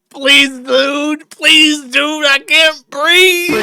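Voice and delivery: high, slow whiny plea; hammed-up pleading victim; mocking the detainee. Streamer parodying the detainee's pleas, high and whiny.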